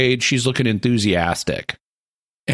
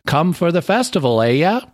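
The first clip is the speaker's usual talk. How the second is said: mock New England local; New England accent; 8 semitones higher than usual. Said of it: "he adopts this sort of New England accent".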